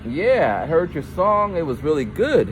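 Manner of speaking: lightly hammed-up compliment; mock enthusiastic praise. Acting out brother's praise.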